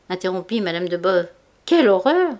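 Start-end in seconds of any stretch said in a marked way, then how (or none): 1.6-2.3 higher, louder scandalised cry; shocked exclamation. A narrator's reading. A society lady exclaims at the scandalous marriage, shocked. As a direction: gasp it out, appalled.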